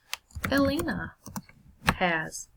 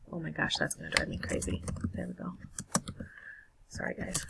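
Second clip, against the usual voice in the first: softer, muttered aside to herself; frustrated aside; 2 semitones lower, 9 dB quieter, faster than usual. Real, not acted. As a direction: mutter it under your breath, mildly exasperated. Frustrated aside while fixing the screen.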